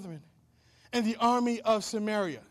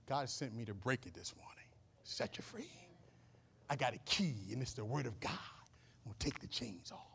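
whisper; 9 semitones lower, 13 dB quieter than usual. Real, not acted. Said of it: "preacher drops to intimate whisper".

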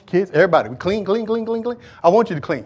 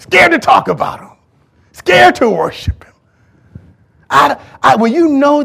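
higher, louder preaching; full-force preaching; 7 semitones higher, 7 dB louder than usual. Acted. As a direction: drive it home to the church.

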